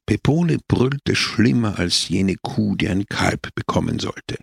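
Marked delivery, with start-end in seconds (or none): none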